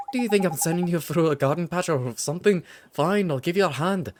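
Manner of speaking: put-on a cheeky Welsh sidekick accent; Welsh-style accent. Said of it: "trying to do a Scottish or Irish".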